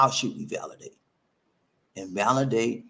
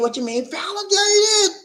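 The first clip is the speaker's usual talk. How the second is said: much higher, loud, slow outburst of disbelief; incredulous shout; 5 dB louder than usual. Retold or imitated. Baffled viewer asking Bobby about validation, shouting. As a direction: blurt it out as if the word makes no sense.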